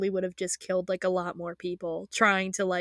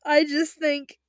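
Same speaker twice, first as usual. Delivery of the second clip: higher tearful voice; crying; 6 semitones higher, 5 dB louder, slower than usual. Real, not acted. Fan trying to talk while crying, tearful. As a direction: push through the tears.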